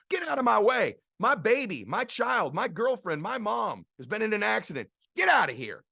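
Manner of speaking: high, loud desperate yell; frantic shout. Retold or imitated. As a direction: shove past them, shouting. Desperate parent pushing through the crowd.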